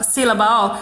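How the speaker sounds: ordinary talk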